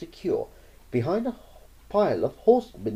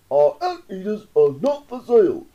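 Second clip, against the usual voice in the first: loud, higher official reply; stern official voice; 9 semitones higher, 8 dB louder than usual. Acted. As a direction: announce it like a stiff official.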